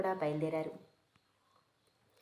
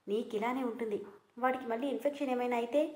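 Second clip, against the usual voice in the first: usual speaking voice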